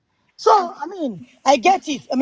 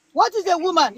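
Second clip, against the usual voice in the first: repeated question pitched higher; 7 semitones higher than usual. Shown as a demonstration. Challenging a panelist, asking again much higher. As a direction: ask it again, higher and insistent.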